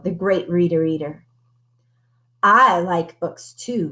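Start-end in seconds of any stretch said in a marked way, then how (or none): none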